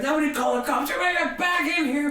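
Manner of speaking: high, hurried crowd herding; imitated urgent order. Organizer stopping hotel calling cops, imitated urgency. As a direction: rush it out to stop the trouble.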